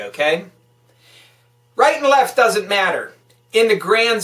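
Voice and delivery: loud, high, furious tirade at the politicians; angry rant. Real, not acted. Podcast host ranting at listeners about the budget, loud and furious. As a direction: hammer every line home with real anger.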